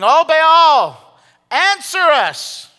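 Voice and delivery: loud, high, slow cry to an idol; calling out. Acted. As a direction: wail it out to a god who never answers. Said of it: "They called upon the name of Baal".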